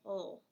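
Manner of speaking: oh said as "ul", the end of middle; suffix-sound demo. Shown as a demonstration. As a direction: sound out oh as a dark "ul". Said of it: "it's just gonna say oh".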